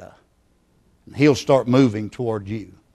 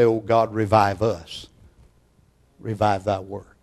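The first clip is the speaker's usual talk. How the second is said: low prayer, sinking softer; prayerful plea; 4 semitones lower, 5 dB quieter than usual. Acted. Preacher voicing the congregation's prayer for revival, quietly pleading. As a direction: let the plea settle into a hush. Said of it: "then together you can say".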